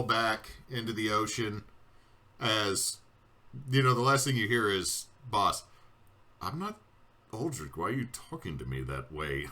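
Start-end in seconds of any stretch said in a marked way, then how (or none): 5.3-9.5 a lightly voiced, honestly puzzled Boss; confused Boss. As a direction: sound sincerely baffled at the insult. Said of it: "honestly and earnestly confused".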